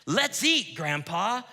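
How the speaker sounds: loud bright call to Grandpa to come eat; cheerful call to eat. Shown as a demonstration. Preacher inviting Grandpa to the meal, loud and cheerful. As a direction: shout it to Grandpa across the house, cheerful. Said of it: "I'll say the exact same words two different ways".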